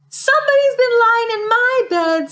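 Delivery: very high tiny bear voice; high, upset wee voice; little wee bear. A narrator's reading. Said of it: "said the little wee boy bear in his little wee voice".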